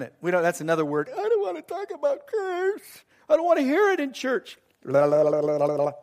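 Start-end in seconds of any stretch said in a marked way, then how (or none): 1.1-4.6 high-pitched mimicked complainer; mock protest. Mimics congregation refusing to hear. 4.8-5.9 rapid monotone la-la babble; mock la-la-la. Mimics blocking out the word.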